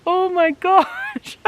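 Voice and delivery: high, slow, stretched "Oh my gosh"; amazed gasp. Retold or imitated. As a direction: gasp in disbelief at the echoing scream. Mother hearing daughter scream echo.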